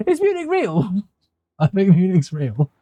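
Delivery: high-pitched and laughing; amused mock doubt. Real, not acted. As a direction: question it in a high voice, breaking into laughter. Laughs at own silly doubt.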